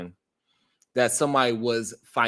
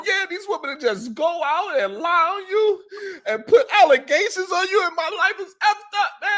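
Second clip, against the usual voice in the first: high, whiny, crying complaint; mock sobbing rant. Host imitates Joseph complaining tearfully about women, mock sob. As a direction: overdo the self-pity. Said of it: "And so he was like".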